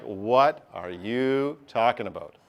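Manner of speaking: slow, drawn-out mock bafflement; baffled student. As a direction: act out a puzzled student, dragging the words. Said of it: "you're gonna be like".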